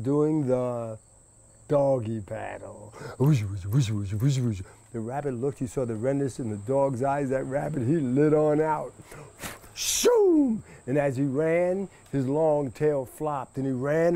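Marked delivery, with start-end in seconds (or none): 3.2-4.6 paddling whoosh. Acted. Dog paddling sound effect. 9.7-10.6 a high whizzing shoom as the rabbit darts away; high zooming shoom. Acted. Rabbit taking off from the dog, high whizzing sound.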